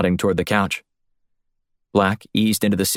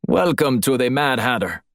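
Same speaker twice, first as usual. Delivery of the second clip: put-on higher-pitched Russian-accented greeting; clipped Russian accent; 4 semitones higher than usual. A narrator's reading. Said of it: "said in a clipped Russian accent".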